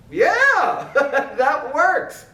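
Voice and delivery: high "yeah" breaking into a chuckle; laughing satisfaction. Acted. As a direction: laugh with delight at the fix. Pleased with the fix.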